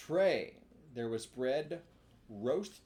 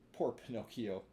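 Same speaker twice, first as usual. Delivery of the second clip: ordinary delivery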